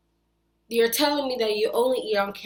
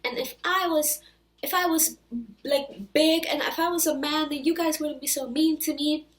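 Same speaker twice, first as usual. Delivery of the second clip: higher mocking whine of Nick; higher mock-pitiful voice; mocking whiny imitation; 7 semitones higher than usual. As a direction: parody his self-pity, high and whiny. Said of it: "saying all these things like".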